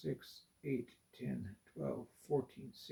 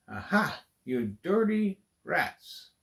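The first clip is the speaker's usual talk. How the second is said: mock outrage; 6 semitones higher, 5 dB louder than usual. Real, not acted. Caught out by a trick.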